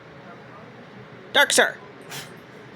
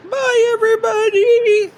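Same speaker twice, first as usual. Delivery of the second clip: much higher cheery bye; high sing-song goodbye; 12 semitones higher, 5 dB louder than usual. Real, not acted.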